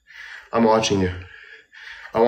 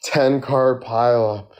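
usual speaking voice

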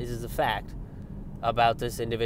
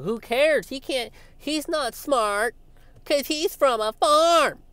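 much higher, slow, sneering mock voice; mocking McCaskill; 13 semitones higher, 4 dB louder, slower than usual. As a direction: parody of elitist contempt. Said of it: "she kind of portrayed him as".

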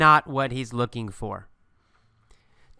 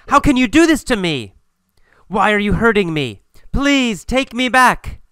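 higher, wounded protest; betrayed pleading; 7 semitones higher, 4 dB louder than usual. Acted. Betrayed Julia begs her boyfriend to take her back, hurt pleading. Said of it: "Julia feels betrayed. She says".

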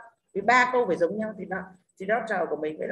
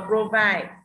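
"provide" slowly, weight on "vide"; slow "provide", second syllable stressed; 5 dB louder than usual. Shown as a demonstration. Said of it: "phải rơi vào âm tiết gì".

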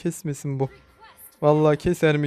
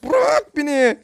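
frantic high pleading; 9 semitones higher, slower than usual. Real, not acted. Gamer pleading with a relentless enemy, frantic. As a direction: plead to be left alone, frantic.

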